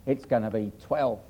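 low, smug weatherman voice, dropped well down; smarmy Michael Fish. Retold or imitated. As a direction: send up a smooth TV forecaster. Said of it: "these smarmy sort of Michael Fish".

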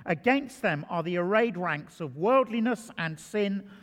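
ordinary talk

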